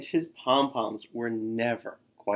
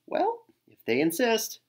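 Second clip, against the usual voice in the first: smug pleased; 10 semitones higher than usual. A narrator's reading. Tacky's pleased reply.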